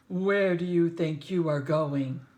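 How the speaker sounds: gruff lion voice. Acted. Lion voice challenging the boy.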